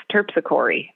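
Terpsichore as TERP-si-CORE-ee; disputed pronunciation. Retold or imitated. Cousin correcting her, Terpsichore with final ee. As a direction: add an ee after core in Terpsichore. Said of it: "she's like, No, it's".

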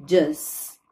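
just with a dj sound like in Jonathan. Shown as a demonstration. Teacher shows the j sound in just. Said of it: "falando a palavra, o nome Jonathan".

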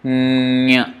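nya hummed through the nose; soft nasal palatal nya. Shown as a demonstration. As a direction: let nya ring in the nose. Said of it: "nasal sound".

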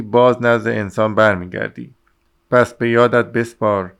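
ordinary delivery

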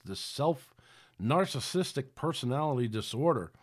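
ordinary talk

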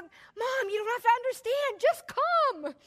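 urgent son. Retold or imitated. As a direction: beg her to just come now. Son urgently pleading on phone.

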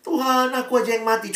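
high pleading cry, lightly acted; pleading mother. Mother pleading with God over her son, high. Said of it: "orang mengasihi sampai rela tukeran".

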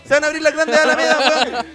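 high loud oratory; hammed-up speech. Negotiator declaims famous speech to Simón, loud. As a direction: declaim like a president.

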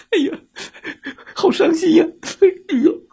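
high wailing, half-laughing sob; mock crying. Little monster starts crying too, mock wailing. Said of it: "小妖怪也哭了".

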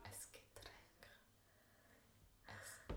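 slow voiceless whisper of Getränk. Real, not acted. Repeating Getränk in a whisper to her friend. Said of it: "du musst flüstern".

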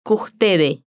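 "Custede" as "kuhtede", S breathed, final S dropped; merged and aspirated. Shown as a demonstration. Teacher modelling the waiter's speech: "Custede", merged with an aspirated S. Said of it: "con la aspiración de la S, queda así".

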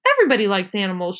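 ordinary delivery

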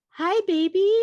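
put-on high, sweet little-girl hello, a gasp before it; toddler voice. Mom imitating toddler greeting baby brother, high and sweet. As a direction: coo it like a thrilled toddler.

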